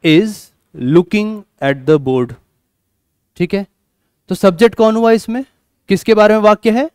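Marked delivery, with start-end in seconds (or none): 0.1-2.3 slow, careful example sentence; slow classroom demo; slow spoken English. Shown as a demonstration. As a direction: read it out slowly for students. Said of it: "जैसे".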